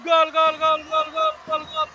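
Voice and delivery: long, loud stretched goal cry; goal scream. Real, not acted. Goal celebration call. (grito de gol prolongado)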